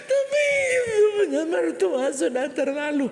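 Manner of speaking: high, slow wailing shout; mock crying complaint. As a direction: wail it like a wronged wife. Wife crying insistence. (mengadu sambil menangis)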